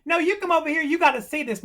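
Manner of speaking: put-on high, loud insistent kid; shouting back. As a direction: holler urgently for the teacher to come look. Acted boy shouting back across street.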